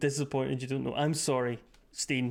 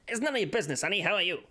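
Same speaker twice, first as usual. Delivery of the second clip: put-on higher-pitched old-time detective patter; nineteen twenties detective; 4 semitones higher, faster than usual. Shown as a demonstration. Streamer showing the style that works, 1920s detective voice. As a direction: wisecrack it like a 1920s gumshoe. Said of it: "in the style of a nineteen twenties detective".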